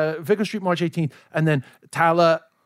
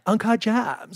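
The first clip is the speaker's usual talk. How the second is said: imitating Julia Fox; 5 semitones higher, much slower than usual. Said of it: "Julia Fox going".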